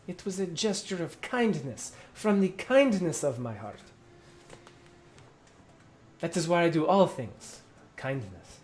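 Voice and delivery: self-righteous captain. Acted. Sensu claims kindness.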